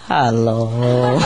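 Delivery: low, drawn-out greeting; parrot's sudden hello. Retold or imitated. Bird interrupts owner's meditation suddenly, low voice. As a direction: break in with a deep hello. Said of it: "the bird suddenly".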